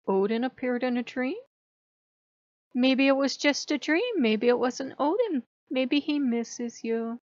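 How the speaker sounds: lightly voiced coy trickster; mock cute. Loki answering the teller's yelling, playing cute. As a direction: bat your lashes and dodge. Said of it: "Stop being cute".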